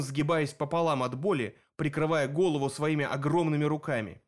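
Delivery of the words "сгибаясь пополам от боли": usual speaking voice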